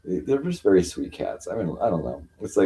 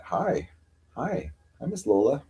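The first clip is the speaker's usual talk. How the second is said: high, soft cooing hellos; cooing at cat; 7 semitones higher, 4 dB quieter than usual. Real, not acted. Greeting her cat Miss Lola, high soft cooing. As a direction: coo gently to the cat.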